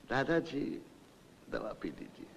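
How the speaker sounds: low, soft request; gentle coaxing demo. Shown as a demonstration. Old man showing secretary how to coax him, softly. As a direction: show how to ask tenderly. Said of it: "कहो".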